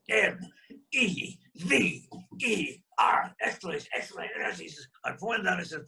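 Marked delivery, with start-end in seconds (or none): 0.1-4.4 letters spelled out very slowly; M E V E R read letter by letter, slow. Retold or imitated. Ed Parker spelling the warning on the blackboard, slow.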